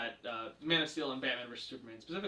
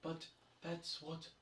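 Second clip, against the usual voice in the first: slow, very soft deadpan; emotionless delivery; 15 dB quieter than usual. Retold or imitated. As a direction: imitate a wooden actress, drained of feeling. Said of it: "there's no emotion".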